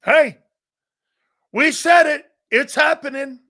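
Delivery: loud excited burst. Real, not acted. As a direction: celebrate the news out loud. Host excited at trade news.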